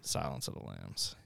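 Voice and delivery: whispered horror title; low, voiceless announcement; creepy hush. Acted. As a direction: whisper it like a scary reveal.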